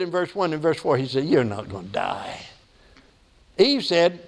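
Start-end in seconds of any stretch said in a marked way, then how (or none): 1.2-2.5 low, soft dismissive tempter; lightly voiced tempter, dropped low and quiet; Satan. Preacher quotes Satan tempting Eve, low soothing dismissal. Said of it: "look at what Satan says".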